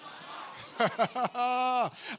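long laugh from the pulpit. Real, not acted. Preacher laughing before praising God, long laugh. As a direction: break into a drawn-out laugh.

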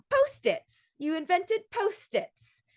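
Post-its? squeaked way up in disbelief; high, slow, mocking disbelief on invented; a hammed-up incredulous mean girl. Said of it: "just the way she says it".

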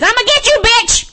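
very high-pitched squawked threat; mock fight threat. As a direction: imitate a brawler's taunt in a high, frantic voice. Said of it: "the most you hear is".

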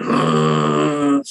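long low, loud rumbling drone; deep droning buzz noise; low device buzz. Retold or imitated. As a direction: imitate a low electronic warning tone. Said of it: "it makes this really low".

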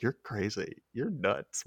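soft, stunned aside; dumbfounded. Retold or imitated. Benedict reacting to Elliot's idea, quietly dumbfounded. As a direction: say it quietly, in mild disbelief. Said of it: "this just dumbfounded expression".

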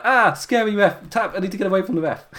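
high, hurried pretend panic; comic imitation of frightened fleeing; mock scream. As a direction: babble it fast like a scared wrestler. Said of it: "was he just scream".

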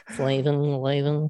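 lightly voiced nerd nonsense word; nerdy voice. Host switching from buttery to nerdy voice, mock nerd. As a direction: play at a nerd for a moment. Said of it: "switch to my, uh, nerdy voice".

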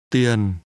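"Tiền" on a low falling tone. Shown as a demonstration. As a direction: let "Tiền" slide steadily downward. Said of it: "pronounced with two low falling tones".

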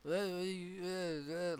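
slow, stretched stoned mumbling; imitating a wasted rocker who can't speak; too high to talk. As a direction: drag out dazed uhs like he can't form words. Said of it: "like, he couldn't talk".

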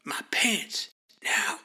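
urgent whisper through clenched teeth. Acted. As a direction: hiss the order without opening up. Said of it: "he whispers through clenched teeth".

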